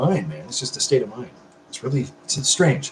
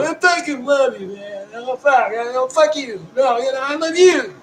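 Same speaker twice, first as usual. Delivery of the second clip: mock-drunk gushing in a much higher voice; emotional buzzed drunk; 12 semitones higher, slower than usual. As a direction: ham up a sloppy, teary drunk declaring love. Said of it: "I'm not getting that emotional fucking buzzed out going".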